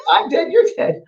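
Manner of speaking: put-on high small child's voice, matter-of-fact; little girl voice. Medium voicing a spirit child in a seance tape, light child voice. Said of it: "a wee little one".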